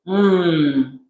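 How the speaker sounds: ordinary delivery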